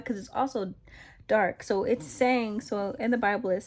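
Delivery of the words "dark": normal talking voice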